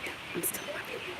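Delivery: soft, fast whisper. Real, not acted. Vlogger whispers that she is still recording. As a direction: whisper so no one hears.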